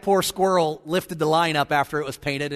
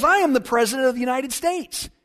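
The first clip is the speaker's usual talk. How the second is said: higher, puffed-up mock indignation; self-important; 6 semitones higher than usual. President boasts to his wife, mock pomposity. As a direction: imitate a husband pulling rank. Husband pulls rank, acted.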